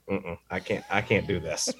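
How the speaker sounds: soft, low mock reluctance; half-mumbled "no way" to himself; muttered refusal. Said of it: "and I'm like".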